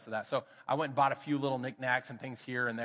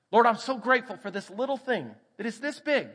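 fervent prayer; 9 semitones higher, 6 dB louder than usual. Acted. Fervent prayer voiced in sermon.

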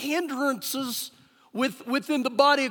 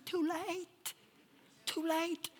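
soft, higher, sing-out answer; gentle retort; 5 semitones higher, 10 dB quieter, slower than usual. Acted. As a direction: quietly lilt it back at the doubters. His soft retort to doubters.

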